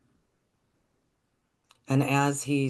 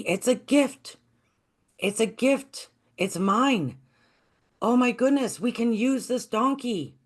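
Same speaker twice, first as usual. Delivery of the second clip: much higher, overjoyed voice; bubbling over with joy; boy answering villagers, high and excited; 9 semitones higher than usual. Acted.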